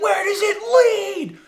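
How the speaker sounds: high, drawn-out shout; yelling ahead. Retold or imitated. Tourist asking about the shortcut, shouting ahead. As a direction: holler the question down the tunnel. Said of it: "And I yell ahead".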